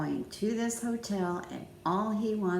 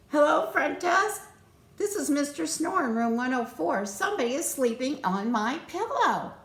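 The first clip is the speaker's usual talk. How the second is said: higher, louder alarmed complaint; upset guest; 7 semitones higher, 3 dB louder than usual. Acted. Mister Snore calling the front desk, alarmed.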